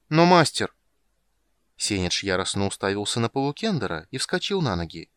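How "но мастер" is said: high loud protest; protesting shout. Acted. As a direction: cry out in protest. Протест крысы. (громкий протест)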